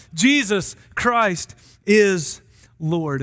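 JESUS CHRIST IS LORD very slow, each word set apart; slow, weighty proclamation of "Jesus Christ is Lord"; emphatic declaration. Real, not acted. Preacher declares climax against Caesar.

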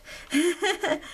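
short high chuckle; light laugh. Real, not acted. Laughs after saying she's doing her best.

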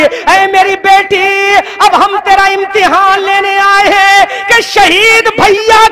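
high, slow lament; Fatima answers her daughter, slow and high; lamenting preacher. Acted. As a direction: stretch each word in mourning. Preacher voices Fatima's reply.

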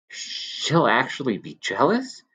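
in a rapture. Acted. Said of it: "cried Alan in a rapture".

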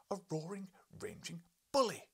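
high, loud angry cry; wife's outburst. Acted. Wife to husband, a high loud furious cry. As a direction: fling the insult at him.

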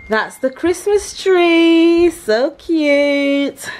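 high, loud, slow delight; excited gushing. Real, not acted. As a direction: coo over it with delight. Gushing at tree.

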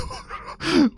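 a high breathy laugh on the exhale; high unvoiced nervous laugh; breathless scared laugh. Real, not acted. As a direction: let the shock out as an airy laugh. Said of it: "eso me ha asustado bastante".

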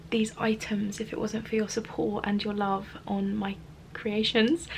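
ordinary delivery

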